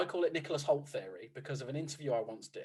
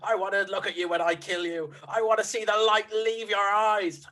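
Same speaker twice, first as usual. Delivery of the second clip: villain yell; 5 semitones higher, 7 dB louder than usual. Retold or imitated. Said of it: "he just yells".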